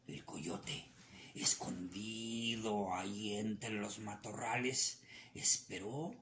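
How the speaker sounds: usual speaking voice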